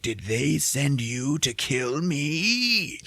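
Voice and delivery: high, very slow, drawn-out character voice; half-mad paranoia. Retold or imitated. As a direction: stretch it out like a hammed-up madman. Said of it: "maybe losing his mind a little bit".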